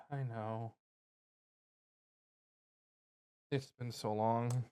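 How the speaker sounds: hushed and slow, full of longing; tender. Acted. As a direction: let it come out soft and slow. Reunion, character speaking tenderly.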